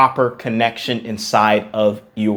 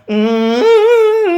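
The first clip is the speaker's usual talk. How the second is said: high hum that breaks; cracking hum; 4 dB louder than usual. Shown as a demonstration. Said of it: "I wasn't going".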